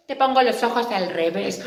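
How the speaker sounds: burla rápida de niña; princesa burlona. Acted. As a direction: se mofa a toda prisa. (princesa se burla rápido)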